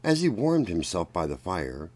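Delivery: ordinary delivery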